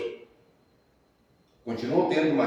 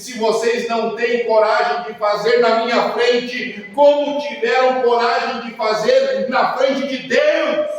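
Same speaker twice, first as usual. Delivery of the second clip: stern rebuke; 10 semitones higher, 9 dB louder than usual. Retold or imitated. Pastor's climactic rebuke.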